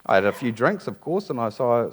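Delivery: ordinary delivery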